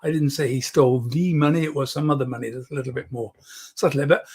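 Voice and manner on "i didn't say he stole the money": "the" stressed and said as "thee". Shown as a demonstration. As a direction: lean on "the", pronounced thee. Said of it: "change the emphasis on the".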